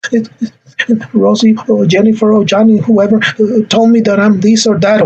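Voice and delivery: high, halting mock-crying voice; tearful child. Shown as a demonstration. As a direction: whimper an explanation like a child. Crying child answers scolding parent.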